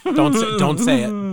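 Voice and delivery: high, loud mock-alarmed plea; urgent warning. Real, not acted. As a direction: cut them off before they say it. Cutting off the French meat joke.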